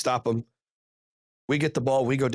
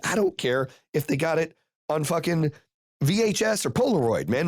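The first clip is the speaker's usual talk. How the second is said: higher-pitched exasperated protest; retold freak-out; 6 semitones higher than usual. Coach argues with the commissioner over a late flag, agitated. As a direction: retell an agitated argument, pitch climbing. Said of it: "I'm freaking out".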